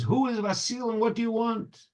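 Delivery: lightly acted distressed woman; woman crying out. A narrator's reading. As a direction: cry out the question without raising the volume. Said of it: "the woman cries and says".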